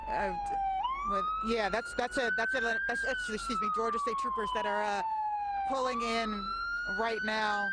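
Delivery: garbled, breaking-up radio signal; choppy distorted live feed; breaking up space radio. Real, not acted. Reporter's live feed cutting out.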